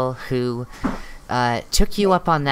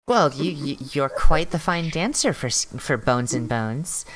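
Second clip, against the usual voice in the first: ordinary talk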